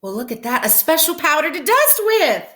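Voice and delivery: bright, delighted discovery, lightly voiced; a touch of wonder at the box; mild exclamation. A narrator's reading. Teacher reading to kids, Amelia's delighted little exclamation. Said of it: "exclaimed Amelia Bedelia".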